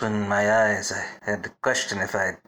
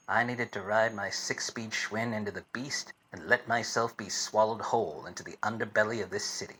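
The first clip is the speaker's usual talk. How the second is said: noir narration; 2 semitones lower, 4 dB quieter than usual. A narrator's reading. Detective narrating his grim case to the audience, soft noir voiceover. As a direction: brood over the case like a tired gumshoe.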